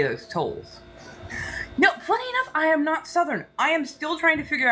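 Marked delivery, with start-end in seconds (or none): 1.8-4.7 high amused aside. Real, not acted. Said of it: "my Southern accent".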